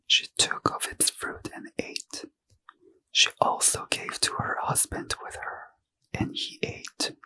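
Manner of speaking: ordinary delivery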